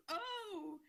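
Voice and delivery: a startled cry at what he sees in the telescope; shocked gasp. Retold or imitated. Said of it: "he's so shocked".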